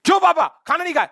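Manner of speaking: high, fast, loud demanding question; daughter's scolding shout. Retold or imitated. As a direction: scold dad as an upset daughter. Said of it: "इनकी बेटी ने कहा".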